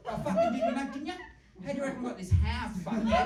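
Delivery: mock outrage. Retold rant at son.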